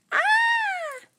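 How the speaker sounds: high shrill scream of fright; startled girl screams in the dark. Acted. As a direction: shriek in surprise. Itty screams.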